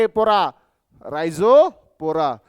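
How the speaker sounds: teacher punning on the plant name; playful pun. Shown as a demonstration. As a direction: stretch रायझोपोरा to make the joke land. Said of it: "pun on name, louder".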